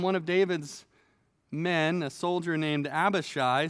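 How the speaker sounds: normal talking voice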